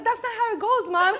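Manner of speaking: high-pitched whiny correction of mom; dumbfounded kid. Retold or imitated. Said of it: "I was dumbfounded".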